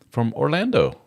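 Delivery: ordinary delivery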